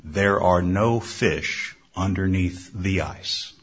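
low, slow booming voice; slow, deep voice from nowhere. A narrator's reading. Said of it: "he heard a deep voice say".

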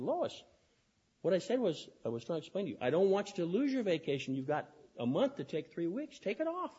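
acted-out pleading clarification; exasperated explaining. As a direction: spell it out, mildly exasperated, voice lifted. Acts out explaining to employee.

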